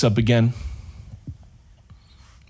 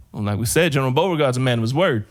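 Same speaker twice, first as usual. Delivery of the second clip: ordinary talk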